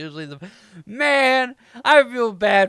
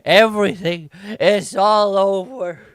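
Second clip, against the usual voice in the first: mock despairing wail, raised in pitch; client screaming; 6 dB louder than usual. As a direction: wail that life is finished, hammed-up. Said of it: "Is that your client screaming over there?".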